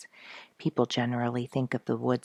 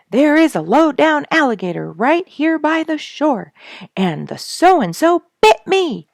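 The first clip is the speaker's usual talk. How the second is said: yelling; 12 semitones higher, 9 dB louder than usual. A narrator's reading. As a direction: shout it out in panic from the water. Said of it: "he yelled".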